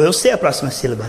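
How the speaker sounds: ordinary talk, nothing marked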